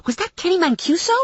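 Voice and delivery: high-pitched incredulous question; astonished. Acted. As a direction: ask it high, amazed by who it was. Friend amazed after the chase.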